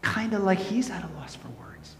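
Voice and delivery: soft, breath-only whisper; hushed awe. Real, not acted. Preacher describing God at a loss for words, hushed whisper.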